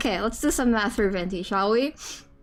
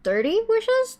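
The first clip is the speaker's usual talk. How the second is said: surprised question; 11 semitones higher than usual. Real, not acted. Surprised at total.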